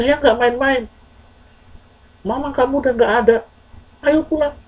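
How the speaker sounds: high, fast, urgent insistence; earnest insisting. Acted. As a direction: make him believe it's serious. Messenger insists news is true. (mendesak dengan sungguh-sungguh)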